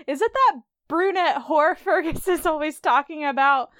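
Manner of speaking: hammed-up jealous wife, changed voice; imitating a suspicious wife, giggling through it; accusing Claire.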